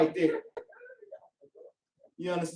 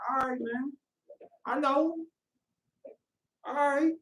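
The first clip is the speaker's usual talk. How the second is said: softer, higher, humoring reply; grudging placating; 5 semitones higher, 5 dB quieter than usual. Acted. Brother humoring an over-happy friend, quiet and placating.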